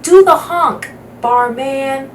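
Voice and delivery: a loud shout from a different voice in the crowd; heckler's shout. Acted.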